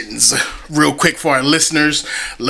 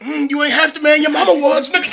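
playful trash talk; 10 semitones higher, much faster than usual. Acted.

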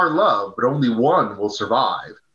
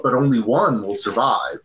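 usual speaking voice

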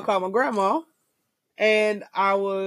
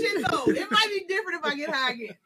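laughing; 8 semitones higher, 4 dB quieter than usual. Real, not acted. Laughing about her high.

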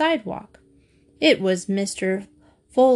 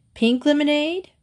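higher, mildly nervous question; 6 semitones higher than usual. A narrator's reading. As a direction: ask warily, pitch lifted. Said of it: "he asked nervously".

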